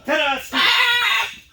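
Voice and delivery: high, slow, loud presenter's ta-da; showman ta-da. Pretend. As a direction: announce the star like a ringmaster. Presenting the dog's trick.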